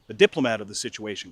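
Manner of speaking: usual speaking voice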